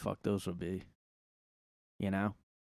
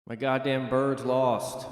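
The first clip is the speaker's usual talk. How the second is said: mock lament; 2 semitones higher, 4 dB louder, slower than usual. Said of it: "repeated lament, swearing".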